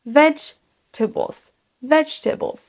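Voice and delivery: VEDGE-ta-bles, vegetables with a long first syllable; first-syllable stress. Shown as a demonstration. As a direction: lean hard on the start of vegetables. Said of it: "un petit peu plus longue et plus fort". (vegetables, première syllabe longue et accentuée)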